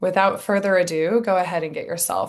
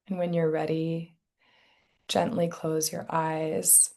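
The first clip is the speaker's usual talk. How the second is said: guided meditation; 7 dB quieter than usual. Real, not acted. Meditation teacher settling listeners into their bodies, soft guided meditation. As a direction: ease them gently into stillness, softly and unhurried.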